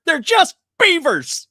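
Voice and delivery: high, loud exasperated yelp; high yelping mock exasperation; exasperated character reply. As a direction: shout it like a fed-up Canadian. Retold scene, exasperated reply.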